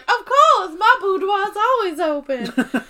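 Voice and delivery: high, loud Southern belle; slow down into a flirty mock Southern drawl; hammed-up Southern accent. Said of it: "And Nellie's like".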